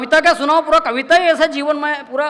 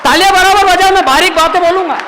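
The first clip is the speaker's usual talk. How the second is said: louder, higher call to the hall; loud higher call asking the crowd for applause; 5 semitones higher, 8 dB louder than usual. Real, not acted. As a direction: rouse the audience to clap. Calling audience to clap.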